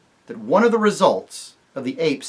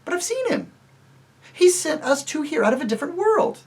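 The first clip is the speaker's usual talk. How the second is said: put-on much higher, eager girl; Jill, earnest; 3 dB louder than usual. Jill tells the dwarfs she saw Aslan, earnest. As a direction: insist with wide eyes.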